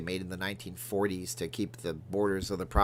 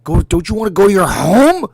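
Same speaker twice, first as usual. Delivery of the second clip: loud high angry yelling at the golf ball; furious high-pitched rant at a golf ball; Billy Madison impression; 7 semitones higher, 15 dB louder than usual. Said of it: "like Billy Madison".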